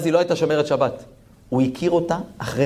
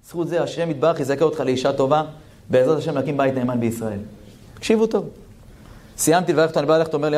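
ordinary talk

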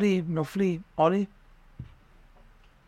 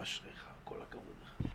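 soft whispered well done; whispered praise; 23 dB quieter than usual. Acted. Friend quietly praising friend, whispered. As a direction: whisper it like a secret blessing.

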